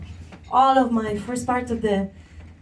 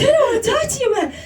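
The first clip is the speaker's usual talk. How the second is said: much higher, squeaky mock outrage; mock angry; 12 semitones higher, much faster than usual. Mimicking the angry stranger who wants to talk. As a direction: whine it in comic outrage. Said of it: "They get angry".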